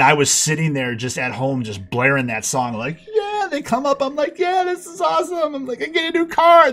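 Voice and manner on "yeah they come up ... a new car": much higher whooping joy; ecstatic. Retold or imitated. Teen cheering at home over his new car, ecstatic. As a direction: celebrate at the top of your voice. Blaring song, celebrating.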